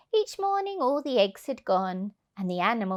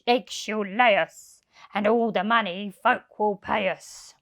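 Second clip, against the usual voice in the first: louder crook's voice, scheming; gruff thief; 3 dB louder than usual. Acted. Hugh pitching his plan to Len, a greedy crook's voice. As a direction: sell the plan like a greedy burglar. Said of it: "But Hefty Hugh said".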